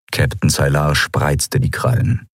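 usual speaking voice

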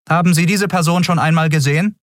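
official questioning. A narrator's reading. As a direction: rattle off the routine question.

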